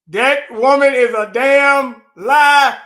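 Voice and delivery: loud, slow, disgusted declaration; angry outburst. Real, not acted. Host reacting to the official on air, loud slow anger. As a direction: drag it out, furious and loud.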